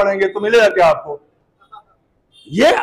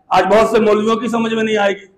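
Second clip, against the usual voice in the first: ordinary delivery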